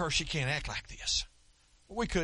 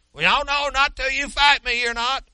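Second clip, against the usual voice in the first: much higher, much louder angry shout; furious demand; 11 semitones higher, 10 dB louder than usual. Retold or imitated. Angry old man yelling at the pastor not to leave, furious. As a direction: yell it, blocking him from leaving.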